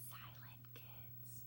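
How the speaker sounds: breathy whisper, no voice at all. Acted. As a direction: whisper it like a secret. Whispers the word silent.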